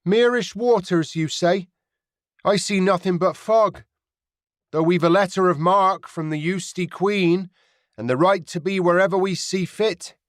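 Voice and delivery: put-on Mary hails the pirate in her rounded inland accent. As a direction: call across the water, proud, rounded inland accent. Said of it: "called over the water in her rounded inland accent".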